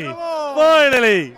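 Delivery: long relieved sigh of a word; drawn-out finally. Real, not acted. Relief after long wait.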